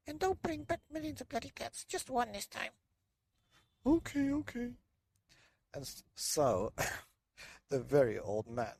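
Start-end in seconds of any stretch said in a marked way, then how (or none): 0.1-2.7 very high, fast nagging voice; nagging old woman. Acted. 3.9-4.7 very high, loud okay okay; weary old man. Acted. Old man giving in to his wife, high voice.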